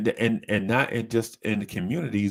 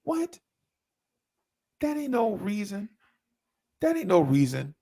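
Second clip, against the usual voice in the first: incredulous; 10 semitones higher than usual. Retold or imitated. Reacting to absurd excuse.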